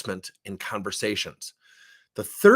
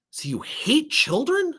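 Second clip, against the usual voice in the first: mock incredulous; 14 semitones higher, slower than usual. Mock probing question to wife.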